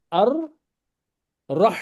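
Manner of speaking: Ar-Rah with the ra doubled and merged. Shown as a demonstration. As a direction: join the alif to a doubled ra in Ar-Rah. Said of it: "this Hamzatul Wasl will combine with Ra".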